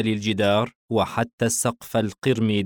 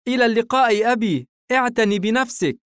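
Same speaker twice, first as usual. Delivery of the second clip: put-on very high, louder boy voice; son's farewell; 14 semitones higher, 5 dB louder than usual. Son seeing his father off, high cheerful farewell. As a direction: call a quick, cheery goodbye as the son. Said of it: "بورسن باسما يودع والده".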